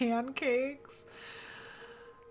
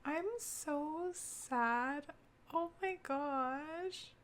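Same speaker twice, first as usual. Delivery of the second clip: slow, soft and high, choked up; real tears; 6 semitones higher, 6 dB quieter, much slower than usual. Real, not acted. Player choking up over a death in the game. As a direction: let the sadness slow you down. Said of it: "why am I tearing up".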